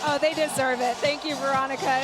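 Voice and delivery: normal talking voice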